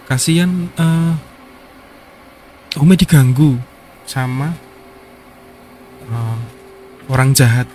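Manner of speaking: put-on a small boy telling his fear; child answering.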